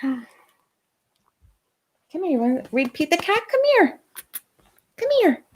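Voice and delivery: very high pet-talk voice. Real, not acted. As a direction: coax the puppy in a high sing-song. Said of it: "calling the dog over".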